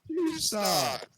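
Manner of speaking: high, loud, drawn-out complaint; mock protest. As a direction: put on an exaggerated protest, loud and slow. Mock protest, then says just kidding.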